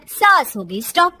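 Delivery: ordinary delivery, nothing marked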